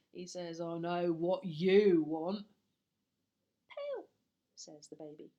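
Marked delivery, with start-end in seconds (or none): 0.6-2.5 slow, lower, loud gruff burglar; knowing rough burglar voice; Burglar Bill voice. Acted. Burglar telling the baby he knows, slow and gruff. 3.7-4.1 put-on much higher, tiny baby voice. Baby copying the burglar, high and small.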